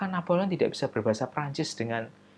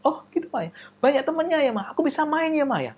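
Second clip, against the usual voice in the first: put-on eager child; faster than usual. Excited child asks.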